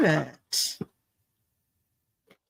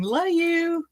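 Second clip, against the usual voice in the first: higher, sweet Southern drawl on "Love you"; imitating a friend's Southern drawl, pitched up; Southern drawl imitation; 9 semitones higher than usual. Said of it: "in her Southern drawl".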